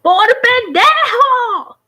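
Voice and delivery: high, drawn-out mock yell; loud, stretched-out imitation of a telling-off; scolding parent. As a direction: holler it like an exasperated parent scolding a kid. Mimics parents scolding hurt child.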